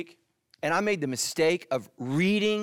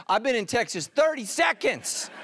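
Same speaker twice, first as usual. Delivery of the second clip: incredulous; 6 semitones higher than usual. Retold or imitated. Retold incredulous reply to Sharon.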